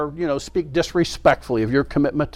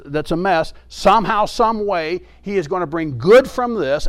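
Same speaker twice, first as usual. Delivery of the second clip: usual speaking voice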